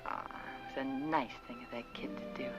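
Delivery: soft, breathy near-whisper; hushed musing. Acted. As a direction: murmur it to yourself, touched. Musing alone after child leaves.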